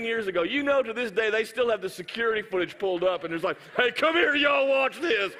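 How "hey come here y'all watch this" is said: loud, high call to coworkers; mock excited call. Gym staff calling others to the security footage, excited loud call. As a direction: call everyone over, drawing out the words.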